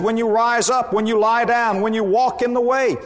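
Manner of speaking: high, ringing pulpit voice with rising fervour; fervent preaching. Acted. Preacher rousing the congregation with Jesus's teaching, fervent pulpit voice. Preacher opening, raised pitch.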